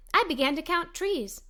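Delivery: usual speaking voice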